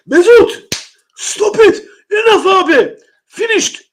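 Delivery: high, loud mock Turkish accent telling Ozil off; a mock Turkish accent, high and loud, scolding Ozil to stop. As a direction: scold him like an exasperated Turkish elder.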